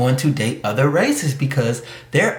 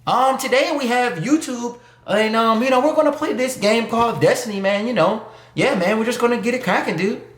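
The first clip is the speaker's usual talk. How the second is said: higher, quick mock gamer intro; mock nerdy YouTuber; 6 semitones higher than usual. Mocking a nerdy gamer's YouTube intro, high and quick. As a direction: parody a dorky gaming channel host. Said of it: "You don't like guys to talk like that".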